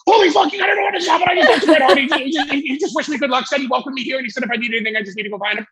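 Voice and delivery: a star-struck rookie laughing as he tells his friends; excited retelling. Retold or imitated. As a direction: blurt it out, giddy and breathless. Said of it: "I grab Bobby Clancy".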